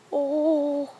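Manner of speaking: loud gasp at the freezing water; loud cold gasp. Real, not acted. Still reeling from ice water.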